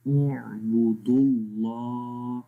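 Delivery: اعبدوا اللہ drawn out slowly with a full, heavy اللہ; slow recitation. Shown as a demonstration. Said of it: "teacher demo of recitation". (اعبدوا اللہ آہستہ آہستہ، اللہ موٹا)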